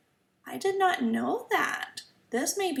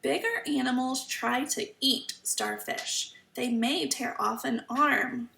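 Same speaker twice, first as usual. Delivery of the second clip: normal talking voice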